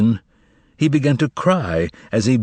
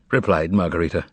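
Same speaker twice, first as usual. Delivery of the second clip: plain reading voice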